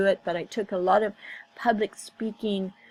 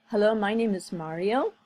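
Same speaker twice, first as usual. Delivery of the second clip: "Hello, my name is Mario." with the pitch rising at the end, like a question; uptalk imitation. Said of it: "he has rising intonation".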